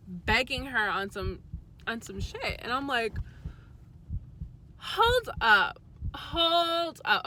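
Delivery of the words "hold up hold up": high, loud startled hold up; shocked hold up. Retold or imitated. Retelling her boyfriend, a high startled hold up. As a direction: stop him cold in disbelief.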